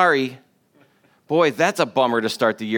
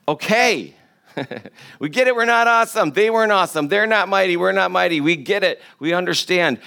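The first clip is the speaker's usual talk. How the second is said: higher, fed-up we get it; exasperated; 6 semitones higher than usual. Retold or imitated. Pastor voicing a fed-up congregation, exasperated and higher. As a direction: play the annoyed congregation, eyes rolling audibly. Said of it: "you're saying".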